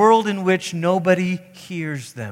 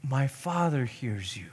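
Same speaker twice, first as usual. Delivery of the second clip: very soft, lowered voice; gentle reassurance; 4 semitones lower, 13 dB quieter than usual. Acted. Jesus answering the one who feels unheard, gentle reassurance. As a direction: answer softly and calmly. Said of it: "And Jesus says".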